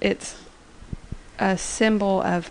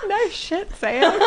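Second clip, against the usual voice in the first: laughing; 13 semitones higher, 3 dB louder, slower than usual. Real, not acted. Echoes retort while laughing.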